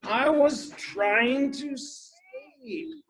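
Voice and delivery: slow, drawn-out grumpy complaint; grumpy tortoise. A narrator's reading. Woken Tortoise complains to Rabbit, slow and grumpy. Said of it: "It's a grumpy tortoise".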